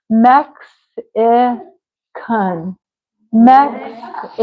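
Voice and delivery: "Mexican" as MEX, ih, kun with pauses; split syllables. Shown as a demonstration. Teacher drills a student's word, syllable by syllable. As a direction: sound out Mexican slowly, piece by piece.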